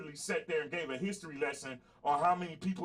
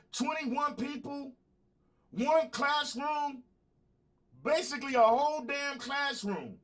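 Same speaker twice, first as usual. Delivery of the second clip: heated rant; 6 semitones higher, slower than usual. Real, not acted. Ranting about the school shooting.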